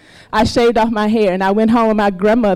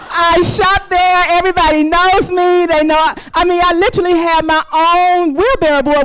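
indignant excitement; 6 semitones higher than usual. Real, not acted. Indignant protest to the asker.

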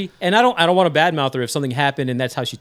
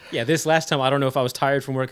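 ordinary talk, nothing marked

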